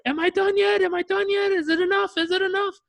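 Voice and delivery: high, loud, desperate yelling; screaming from shower. Retold or imitated. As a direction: imitate a frantic client screaming to be let out. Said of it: "he's screaming from the shower".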